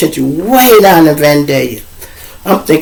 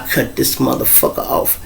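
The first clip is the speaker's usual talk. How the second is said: hushed mock threat; 2 semitones lower, 9 dB quieter than usual. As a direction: whisper the threat like her. Mocking girl's threat.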